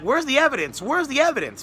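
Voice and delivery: high-pitched, repeated demand with falling ends; insistent demand. Retold or imitated. Answering the believers over here, high insistent demand. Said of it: "I'm over here like".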